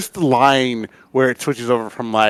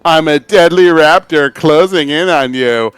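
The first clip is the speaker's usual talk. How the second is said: mock Valley girl voice, a bit louder and slower; Miller as a Valley girl raptor; 4 semitones higher, 6 dB louder, slower than usual. Mocking friend Miller role-playing a raptor, in a hammed-up Valley girl voice. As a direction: ham it up as a ditzy apex predator. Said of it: "Miller doing Varplay would be like".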